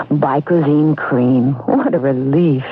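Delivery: ordinary talk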